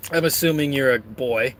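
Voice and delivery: ordinary talk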